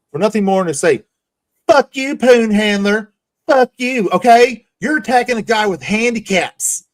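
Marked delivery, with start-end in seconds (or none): 1.7-6.8 higher, louder imitated rant; hammed-up furious comeback; imitating Rich's angry panel rant, higher and louder; mock angry yell. Imitating Rich yelling at him.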